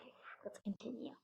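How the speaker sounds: quick soft whisper; whispered and brisk; whispered aside. Real, not acted. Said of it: "aside to child".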